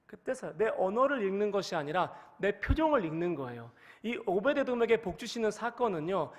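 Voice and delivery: ordinary delivery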